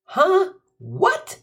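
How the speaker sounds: high, baffled squeak; can't believe it; dumbfounded. Real, not acted. Narrator reacts to the wrong spelling, high and baffled. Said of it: "I'm dumbfounded".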